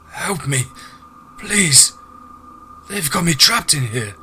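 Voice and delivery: high, urgent cry for help; desperate pleading. Acted. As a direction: plead like a trapped captive, pitch leaping up. Caged man begs for help.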